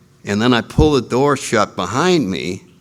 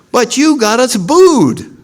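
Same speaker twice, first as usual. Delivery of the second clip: sore complaint; 9 semitones higher, 6 dB louder, slower than usual. Retold or imitated. Complaint rising to a peak.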